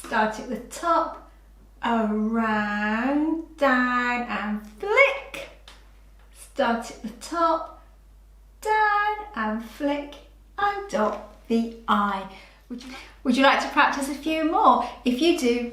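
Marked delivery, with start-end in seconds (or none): 1.8-5.5 slow, drawn out. Shown as a demonstration. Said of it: "Let's do it together". 8.6-12.3 slow, drawn out. Shown as a demonstration. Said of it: "Let's do it together".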